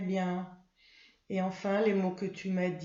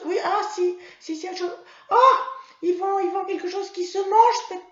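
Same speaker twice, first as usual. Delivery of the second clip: put-on much higher, louder giggly child puppet voice; Mimi; 9 semitones higher, 10 dB louder than usual. Mimi the puppet struggling to answer her teacher, high child voice.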